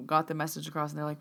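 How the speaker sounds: ordinary delivery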